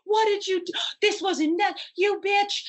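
very high-pitched mock outcry; upset fans. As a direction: squeal it like distraught fans imitated in a story. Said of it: "were beside themselves upset".

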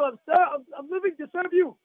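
high, loud cry of devotion; fervent declaration. Shown as a demonstration. Rabbi showing how to greet Hashem at waking, fervent declaration. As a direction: declare it with full fervor. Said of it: "you say it like that".

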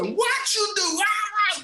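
put-on very high accented yell; screaming coach. Coach Bora screaming at his sub, high yell. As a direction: scream at the unprepared player. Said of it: "starts screaming".

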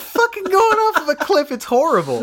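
high, loud outburst over the carnage; exclaiming in mock horror. Real, not acted. Laughing horrified at film scene.

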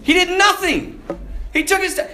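usual speaking voice

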